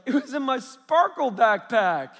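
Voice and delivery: put-on high, loud, drawn-out child's voice; excited little girl. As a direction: announce it proudly like a small kid. Daughter announces where watch was.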